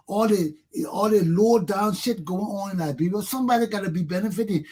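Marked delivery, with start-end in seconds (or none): none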